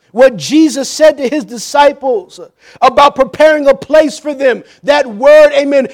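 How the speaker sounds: loud, high-pitched, driving sermon delivery; preach. Real, not acted. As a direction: preach it at full force, punching key words. Preaches at full force.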